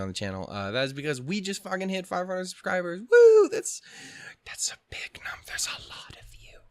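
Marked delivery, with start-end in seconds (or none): 3.0-3.8 high, loud whoop of joy; excited cheer. Real, not acted. Celebrating 500 subscribers. 4.4-6.6 awed whisper. Real, not acted. Awed at subscriber number.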